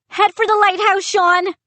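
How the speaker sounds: high, loud call; boy calling to his friend to flee. A narrator's reading. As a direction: shout across the room. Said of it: "I called".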